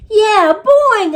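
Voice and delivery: high, loud, thrilled boing; excited hamster reply. A narrator's reading. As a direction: answer with delight. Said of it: "I replied".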